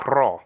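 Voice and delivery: rho with a rough, trilled R at the start; rolled Greek R. Shown as a demonstration. Introducing the Greek letter, rho with a trilled R. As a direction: roll the R hard in rho. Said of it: "Say rho".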